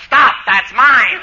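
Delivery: mock yell. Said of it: "she yells".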